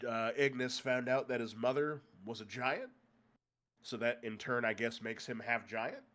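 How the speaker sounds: ordinary delivery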